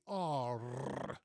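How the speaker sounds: a slow, strongly rolled Frisian R in "R". Shown as a demonstration. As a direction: trill the "R" long and hard. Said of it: "I really exaggerate the rolling R".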